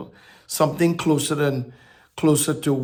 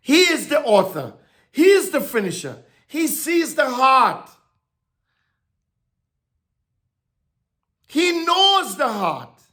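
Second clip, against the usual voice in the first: preaching; 7 semitones higher than usual. Acted. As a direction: lift each line higher to proclaim it. Preaching about God.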